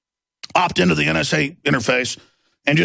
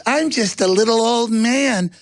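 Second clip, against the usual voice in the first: put-on higher, slow, frail little-old-man voice; mock harmless old man; 7 semitones higher, slower than usual. Mocking Warren Buffett's photo pose with a higher, frail voice. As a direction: play it small and innocent. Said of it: "he goes".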